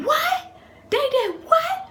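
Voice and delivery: loud, very high squawk of disbelief; hammed-up high-pitched motherly shock; imitated shocked mother. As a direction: screech it in mock outrage.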